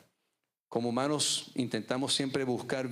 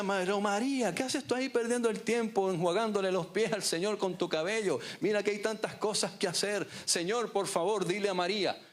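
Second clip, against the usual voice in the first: much higher, fretful complaining sister; sermon voicing of Marta scolding María; anxious Marta; 9 semitones higher than usual. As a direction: whine at her sister, pitched way up. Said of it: "Y Marta decía".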